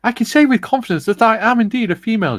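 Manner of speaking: high, loud put-on lady voice. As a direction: read it in a grand lady voice. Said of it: "Tiff says".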